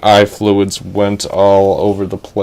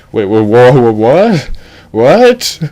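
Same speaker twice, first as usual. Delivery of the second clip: shocked; 2 semitones higher, 5 dB louder, slower than usual. Acted. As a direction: splutter in disbelief. Edge shocked by revelation.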